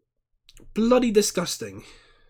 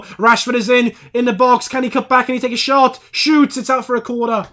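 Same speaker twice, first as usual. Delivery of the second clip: excited commentary; 4 semitones higher, 6 dB louder than usual. Real, not acted. Live match building to a shot.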